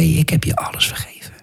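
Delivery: soft, voiceless whisper; whispered reassurance. Retold or imitated. Jesus tells the preacher he is forgiven, whispered. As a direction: whisper it as tender absolution.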